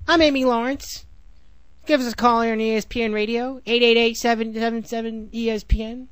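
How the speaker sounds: teasing imitation of a radio host reading the number; Amy Lawrence radio plug. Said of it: "radio host sign-on again".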